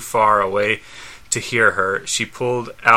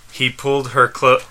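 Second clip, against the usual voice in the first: ordinary delivery, nothing marked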